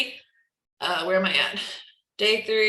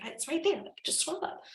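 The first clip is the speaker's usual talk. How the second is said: high, hushed, half-voiced retort; hushed aside; 9 semitones higher, 8 dB quieter than usual. Coach imagining her reply to a customer, hushed aside. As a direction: say it softly under your breath.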